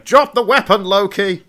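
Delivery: high, loud helicopter loudspeaker; police megaphone call. Retold or imitated. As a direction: order Loki down from the sky. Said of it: "like a police helicopter, going".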